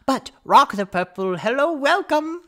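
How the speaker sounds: very high, loud bookseller voice; gushing shopkeeper. Acted. Bookseller welcomes the wizard, high gushing voice. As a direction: swell into a high, effusive welcome. Shopkeeper gushes over Rock.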